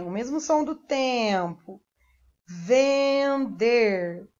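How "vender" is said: "Vender" long, nasal "ven" held, then "der"; sounding out syllables. Shown as a demonstration. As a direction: stretch both halves of "Vender". Teacher sounds out the word. ("Vender" esticado em duas sílabas)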